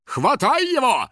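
slow, high barked order; shouted command. Acted. Third guard ordering a capture, commanding. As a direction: give the order, high and stretched. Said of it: "скомандовал третий".

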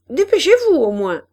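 sulky order, thrown out loud; grudging. A narrator's reading. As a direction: call back crossly while leaving. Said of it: "s'en alla en rechignant".

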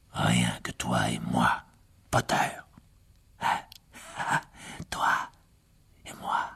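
soft, low menacing whisper; quiet threat, savouring it; villain's whisper. A narrator's reading. Riddle to Harry alone, a cold whisper.

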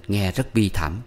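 ordinary delivery

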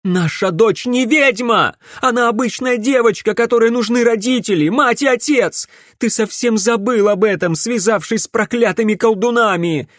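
high, loud reproach; impassioned angry protest. A narrator's reading. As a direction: plead and accuse at full pitch. Mother argues for her daughter. (страстный возмущённый протест)

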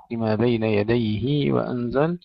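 يديه recited as yadayhi with a long final ee; lengthened linking haa. Shown as a demonstration. Teacher demonstrating Ibn Kathir's reading, lengthened يديهي. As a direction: stretch the ه of يديه into hee before وأنزل. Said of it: "صلة الهاء لابن كثير".

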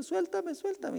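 very high, whiny let me go; pleading to be let go. Pretend. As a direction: squeal like a child wriggling free. Sinner struggling against God.

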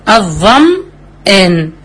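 الظمآن drawn out very slowly, meem sounded cleanly before the hamza; clear meem. Shown as a demonstration. Teacher demonstrating the word for students, very slow and clear. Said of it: "طب كلمة الظمآن".

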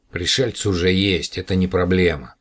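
ordinary delivery

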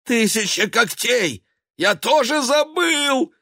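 high, loud, despairing yell; desperate yell. Acted. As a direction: scream it in panic. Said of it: "отчаянно завопил чёрный кот".

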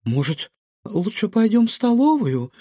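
high-pitched, anxious suggestion; nervous. A narrator's reading. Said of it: "нервно предложила Илона". (нервно)

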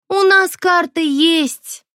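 slow, high, mannered drawl; affected drawl. A narrator's reading. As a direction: drawl it affectedly, rolling eyes. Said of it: "манерно протянула она". (манерно протянуть)

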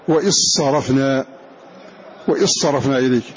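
وإذ صرفنا said "wa-issarafna", with a doubled ص; dh merged into s. Shown as a demonstration. Teacher drilling students: وإذ صرفنا as "wa-issarafna". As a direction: drop the ذ into an emphatic ص. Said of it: "ننطق بالذال مدغمة في الصاد".

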